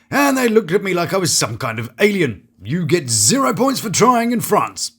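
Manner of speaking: normal talking voice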